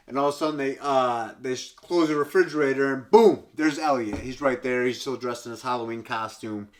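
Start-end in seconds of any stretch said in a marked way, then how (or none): none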